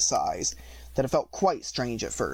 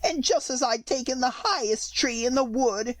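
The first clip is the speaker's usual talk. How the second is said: fretful pigeon; 8 semitones higher than usual. A narrator's reading. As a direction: drag out the complaint like a fussy pigeon. Pigeon complaining.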